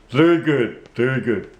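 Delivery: ventriloquist dummy voice; puppet voice. Acted. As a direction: speak as the puppet. Puppet praises.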